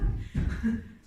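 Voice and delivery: a quick breathy laugh; short laugh. Real, not acted. Youth speaker laughing at Wesley's confused "What?", a quick laugh. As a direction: laugh it off briefly.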